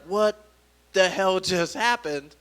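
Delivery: usual speaking voice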